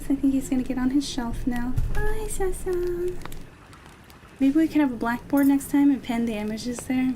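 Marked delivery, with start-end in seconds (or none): 2.0-3.1 high, slow hello to a pet; high pet greeting. Real, not acted. Streamer calling to Sasa heading for the shelf, high slow pet voice.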